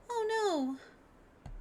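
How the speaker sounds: put-on very high, dismayed girl voice; high cutesy character. Chum hearing of the lost package, high cutesy dismay. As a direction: squeal a small high dismay. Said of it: "It's so high".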